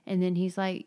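ordinary delivery

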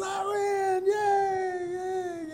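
high-pitched squeal of victory, stretched out; long, silly high "yay" fading off; mock gleeful cheer. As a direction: whoop like you just beat everyone into the pool. Acts out toothache man jumping in.

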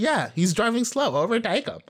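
high, breezy mock friends urging her on. Friends in car urging driver to pass cop, breezy high voice.

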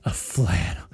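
breathy sultry whisper; low seductive breathy delivery; sexy syrup voice. Pretend. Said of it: "How do you do a real sexy syrup voice".

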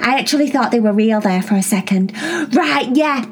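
put-on excited kid asking her sisters questions; Cherry voice.

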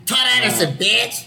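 high, brash announcement of one's own name; cocky name slate. Shown as a demonstration. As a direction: throw the name out with swagger. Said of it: "You gotta say it like".

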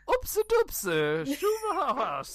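high slow sing-song gibberish; made-up language, stretched out high; fake foreign language. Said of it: "this was a fake language".

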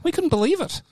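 put-on very high Kiwi accent drifting South African; botched New Zealand accent. Podcaster botching Colin's quote, high New Zealand-turned-South African accent. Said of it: "That's kind of South African".

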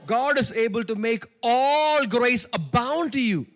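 slow, loud, ringing preacher proclamation; preaching at full force. Acted. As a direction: stretch the verse out loud like a pulpit cry.

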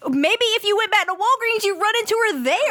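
high-pitched, incredulous scolding; exasperated rant. Real, not acted. Host scolds the Craigslist poster, exasperated and high. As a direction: berate the poster, pitch shooting up. Host rants at the post's writer.

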